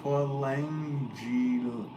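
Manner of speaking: sounding out a hard name syllable by syllable, "Polangil-" drawn out slowly; slowly sounding out "Polangil-" as if reading it off the screen; careful slow attempt. Real, not acted. Streamer struggling to say a viewer's name, slow careful sounding out. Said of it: "I wanna say it right".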